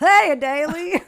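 high, loud, slow auntie greeting. Pretend. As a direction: sing it out like a chatty aunt. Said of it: "You sound like Aunt Jackie when you say her name".

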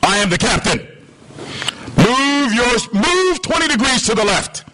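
high-pitched imperious order; commanding captain. Acted. Warship captain signalling a ship to move, commanding. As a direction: give the order as the man in charge. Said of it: "Signal goes out".

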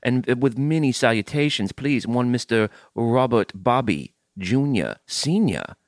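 bow and fuss like an antebellum host; Southern gentleman accent. Acted. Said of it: "here in the antebellum South".

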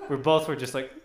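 ordinary talk, nothing marked

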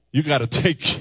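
ordinary talk

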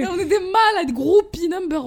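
high, loud, breaking into laughter; laughing, crying out. Real, not acted. Admitting to her cohost she's a mad fan, laughing. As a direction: confess being a fan while laughing helplessly. Fangirl confession about Majdoulin.

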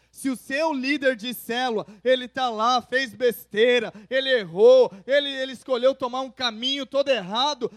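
loud, rising sermon climax; fervent pulpit proclamation; full-force preaching. Acted. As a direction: preach it out to the whole church.

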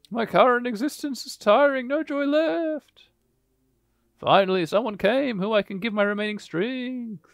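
high, tired ghost voice; weary ghost. Acted. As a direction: sigh it out like a worn ghost. Reads ghost's lines.

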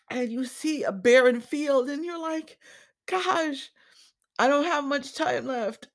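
fighting tears as the words come out; choked up. Real, not acted. Podcaster tells listeners about finding her legacy, voice choking up. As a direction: voice rising high and tight with held-back tears. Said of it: "I may get choked up a bit".

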